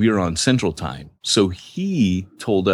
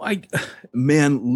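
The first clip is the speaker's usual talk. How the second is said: ordinary talk, nothing marked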